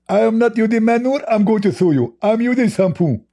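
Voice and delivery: high, mocking put-on voice; mock commenter voice. Reading out a commenter's boast to mock him, in a high put-on voice. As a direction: parrot the commenter in a silly high voice.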